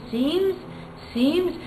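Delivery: high, indignant echo of the word; incredulous Hamlet. Retold or imitated. Hamlet scorning his mother's word, incredulous and high. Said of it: "and he says".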